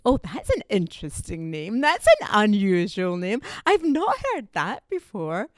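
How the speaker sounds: hammed-up intrigue; exaggerated curiosity. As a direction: stretch it out as an over-interested stranger, pitch lifting at the end. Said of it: "they'll say to me".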